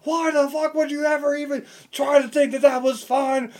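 imitating a raging coach who's had it; furious coach yell. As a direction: tear into the players as the angry coach. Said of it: "Dude, he ripped into us".

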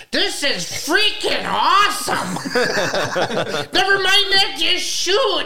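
high, squealed imitation of a thrilled kid; excited little kid. Friend imitating Kevin on his duck hunt, excited little kid. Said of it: "He sounds like he's just a little kid".

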